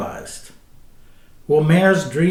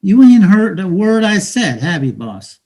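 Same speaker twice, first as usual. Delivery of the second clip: street hustler taunting a newcomer; bitter, mocking street talk to a dreamer; cynical hustler; 4 semitones higher, 8 dB louder than usual. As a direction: talk down to him like a weary hustler.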